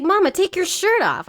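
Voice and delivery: put-on high-pitched little kid demand; four-year-old boy. Son to his mother in a retelling, high little-boy voice. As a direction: imitate a small boy ordering Mama. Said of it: "he was like".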